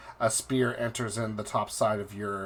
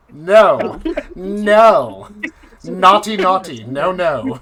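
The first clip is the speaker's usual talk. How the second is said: high, loud laughing no; laughing loud protest; 7 semitones higher, 13 dB louder, slower than usual. Real, not acted. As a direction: shout it out while laughing.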